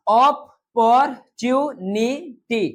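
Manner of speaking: op, por, tu, ni, ti each said separately; syllable by syllable. Shown as a demonstration. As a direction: break the word into its syllables one by one. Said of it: "teacher splits the word into syllables".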